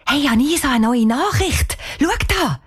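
high, eager call; excited. Acted. Cheyenne showing Anisa a new message, excited. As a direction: call the friend over, pitched high.

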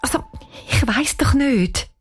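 high, halting, unsure voice; flustered. A narrator's reading. Cheyenne answering her friend about the police, flustered.

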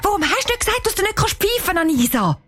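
high-pitched heated squabble; snappish, cross. A narrator's reading. Two girls blame each other, angry. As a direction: fire back accusations, rushing towards the end. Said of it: "sagt Anisa hässig".

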